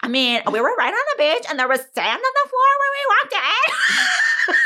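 put-on high, laughing bratty girl voice; spoiled teen girl. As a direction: whine like a pampered teen.

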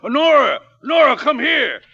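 loud excited call across the house. Acted. Father calling Nora to greet an old friend, loud. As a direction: call her in, thrilled.

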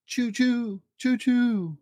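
high choo-choo like a train; train whistle. Acted. Dad reading to a child, train choo-choo. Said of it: "like a train".

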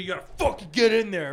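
a hint of a hyped-up coach; coach's urging. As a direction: mimic a coach urging on a lifter. Retells coach urging lifters on.

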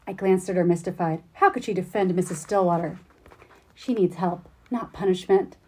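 expressive read-aloud of a novel passage; story reading. Acted. The host reads the novel's scene to its author, an animated reading.

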